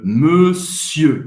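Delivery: monsieur drawn out, rounded E with lips like O, no final R; monsieur, slow, closed rounded first vowel, silent R; slow model word. Shown as a demonstration. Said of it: "Esse E faz a boca do O".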